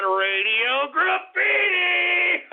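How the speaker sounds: slow, drawn-out shouted segment intro; radio announcer call. Acted. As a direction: announce it big like a radio host.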